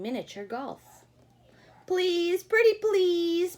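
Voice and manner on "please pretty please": high, loud drawn-out plea; pleading mice. Acted. Mice pleading with the butterfly, high drawn-out begging. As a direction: beg sweetly, stretching pretty please. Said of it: "pleaded the mice".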